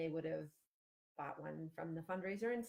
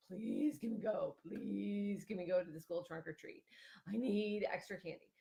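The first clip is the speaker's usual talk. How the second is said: put-on begging kid; 2 semitones higher than usual. Kid begging a parent for trick-or-treat candy, pleading. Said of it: "your kid who's like".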